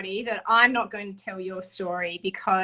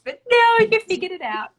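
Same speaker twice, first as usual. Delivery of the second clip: high and loud, then easing; thrilled she figured it out; delighted praise; 13 semitones higher, 6 dB louder than usual. Real, not acted. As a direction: cheer her on. Praising guest for solving it.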